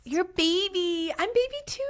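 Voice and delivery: gushing sing-song kinship; cutesy cooing. Real, not acted. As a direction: coo it slowly, sliding way up in pitch. Cooing over being youngest siblings.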